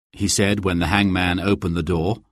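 ordinary delivery

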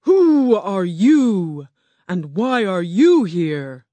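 slow, booming beast voice of Oz; grand slow roar from a huge animal. Acted. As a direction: roar it out slowly like a great beast. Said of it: "the huge animal roared".

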